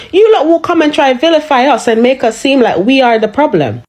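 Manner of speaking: ordinary talk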